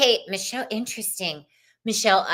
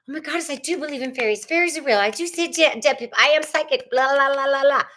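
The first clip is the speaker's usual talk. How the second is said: higher, louder mock fairy-believer gush; mock woo believer; 5 semitones higher, 4 dB louder, faster than usual. To viewers, mocking talk of fairies and psychics, in a hammed-up gushing voice. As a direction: gush like a starry-eyed psychic, tongue in cheek.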